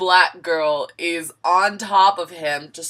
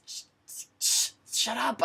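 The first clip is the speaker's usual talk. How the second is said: stammered whispered shush; hushed shush; 5 semitones higher, 7 dB quieter than usual. Retold or imitated. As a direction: hush him without dad hearing. Hushing guy while on phone.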